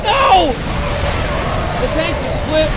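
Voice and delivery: high, loud escalating yell; shouting no. Real, not acted. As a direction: scream no in dismay. Caster screams at the engagement.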